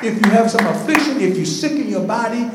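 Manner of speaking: high, emphatic preaching building to a shout; impassioned preaching. Real, not acted. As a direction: drive it home, rising to full-force shouts. Preaching builds to full force.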